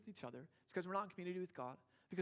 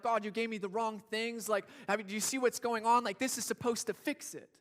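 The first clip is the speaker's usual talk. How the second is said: loud, indignant complaint thrown upward; hurt protest shouted at heaven; yelling at God; 4 semitones higher, 8 dB louder than usual. Retold or imitated. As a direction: demand answers from God, loudly. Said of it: "They look at God, and they're like".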